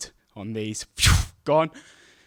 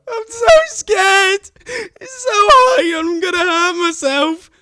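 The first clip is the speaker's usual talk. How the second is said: much higher, louder, slow crying whimper; whiny scared kid; 12 dB louder, much slower than usual. Retold or imitated. Frightened boy stuck on monkey bars, crying high and slow. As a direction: wail it like a terrified child.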